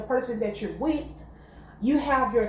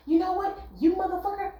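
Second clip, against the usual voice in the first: high mock snap at a partner; mock insult; 8 semitones higher, faster than usual. Mocking how not to curse at your partner, high mock snap. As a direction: pitch it up like a cursing fight. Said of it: "Don't have to scream".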